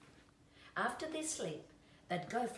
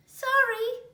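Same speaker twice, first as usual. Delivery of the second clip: high, loud apologetic animal voice; creature voice from dark; 14 dB louder than usual. A narrator's reading. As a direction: pop out of the dark with a sheepish sorry. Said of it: "said a voice from the dark".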